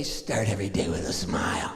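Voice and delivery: deep grandma voice, even while mad; grandma's motto, even when mad. Retold or imitated. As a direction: say it deep and steady like an angry grandma. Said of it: "she'd say".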